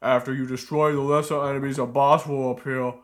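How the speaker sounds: loud, dopey cartoon ogre bellow. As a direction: boom it out like a dumb brute. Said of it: "I was trying to do an ogre voice".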